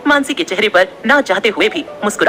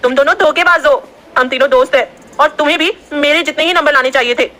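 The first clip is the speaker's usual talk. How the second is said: put-on raised, annoyed girl's shout; shouting angrily; 6 semitones higher than usual. A narrator's reading. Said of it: "उसने चिल्लाते हुए कहा". (गुस्से में चिल्लाकर)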